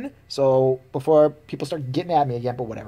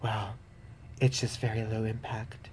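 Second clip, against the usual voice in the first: lower, much softer prissy voice; mock smug jogger; 6 semitones lower, 13 dB quieter than usual. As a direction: say it like a self-satisfied yuppie. Mocking yuppie jogger woman.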